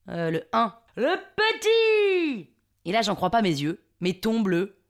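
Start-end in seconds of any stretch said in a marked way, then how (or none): 1.0-2.5 high, slow southern French holler; hammed-up southern French accent. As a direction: bellow a bad imitation of Marseille accent. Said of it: "Je prends mon plus bel accent du Sud". (faux accent du Sud, crié et traînant)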